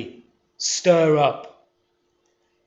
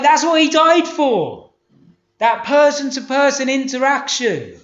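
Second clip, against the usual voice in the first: high-pitched, fired-up sermon delivery; driving, insistent pulpit voice; impassioned preaching; 6 semitones higher than usual. Real, not acted. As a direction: preach it fast and urgently to the congregation.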